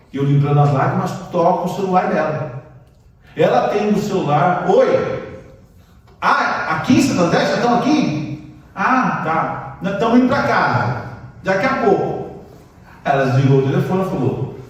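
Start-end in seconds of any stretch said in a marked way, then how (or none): 4.7-12.4 lightly voiced wife, higher, chatty; a hint of the wife taking a call; wife on the phone. Husband voicing his wife answering the phone, lightly higher.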